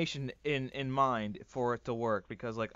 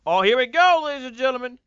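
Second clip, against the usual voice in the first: showman announcement; 11 semitones higher, 13 dB louder, faster than usual. Pretend. Streamer announces his transformation.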